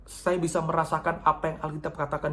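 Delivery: normal talking voice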